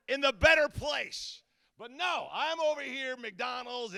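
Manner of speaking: loud, high comic rant; hammed-up exasperation at missing out; mock outrage. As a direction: wail it indignantly, stretching the key words. Mock outrage at lost lounge years.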